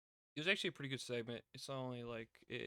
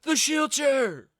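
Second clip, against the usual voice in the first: screamed far higher and louder, second line slowed; mock fan hype; 19 dB louder, slower than usual. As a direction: ham up the excited fans on Twitter. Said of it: "everyone's just like".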